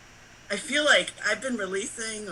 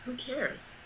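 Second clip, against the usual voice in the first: soft, offhand imitation of a shrug; retold indifference; 3 semitones higher, 11 dB quieter than usual. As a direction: quote the roommate's not caring, quietly. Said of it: "of not caring".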